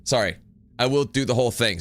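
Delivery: ordinary talk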